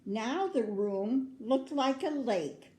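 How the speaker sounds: ordinary delivery, nothing marked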